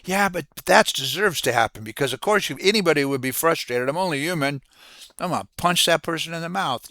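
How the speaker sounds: imitating a worked-up, frustrated outburst; frustrated venting. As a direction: voice the indignant self-justifying inner rant. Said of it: "it just frustrates me".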